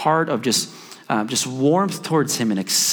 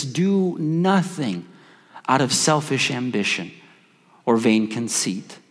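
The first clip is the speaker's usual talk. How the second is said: scripture reading; 2 semitones lower, slower than usual. Acted.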